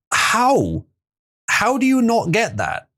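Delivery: high-pitched, drawn-out disbelief; high, exasperated disbelief; incredulous. Real, not acted. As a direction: ask it slowly in utter bafflement. Baffled by a chat message.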